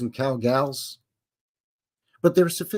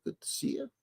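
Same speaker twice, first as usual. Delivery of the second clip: whispered greeting; 14 dB quieter, faster than usual. Real, not acted. Greeting friend Bill in chat.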